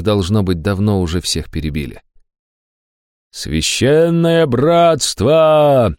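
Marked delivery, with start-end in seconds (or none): 3.3-5.9 slow, drawn-out, higher shouted cry; battle cry shout. A narrator's reading. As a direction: bellow it across the battlefield. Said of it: "заорал кто-то". (протяжный боевой клич)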